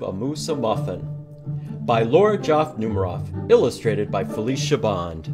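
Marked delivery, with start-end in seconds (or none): none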